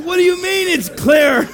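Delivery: high, loud outburst of disbelief; imitated incredulous yell. Guard hearing the area is clear, incredulous shout. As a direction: yell it as an astonished challenge.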